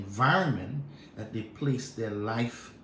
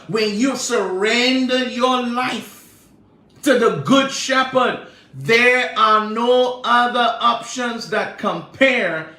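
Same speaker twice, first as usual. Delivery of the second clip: higher, louder preaching cadence; full-force preaching; 10 semitones higher, 7 dB louder than usual. Real, not acted. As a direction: preach it with rising fire.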